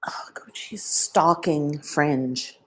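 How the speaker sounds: slow soft whisper. Real, not acted. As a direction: whisper it slowly.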